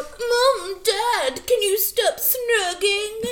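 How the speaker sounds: high, slow whining plea; whiny bratty teen. Retold or imitated. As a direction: whine it like a spoiled teenager. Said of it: "she's all".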